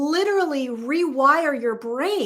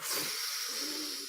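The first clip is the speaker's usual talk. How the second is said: a long airy whoosh like a whale blowing out water; soft imitated blowhole exhale; whale spout puff; 5 semitones higher, 14 dB quieter than usual. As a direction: puff out air like a whale surfacing. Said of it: "It was like".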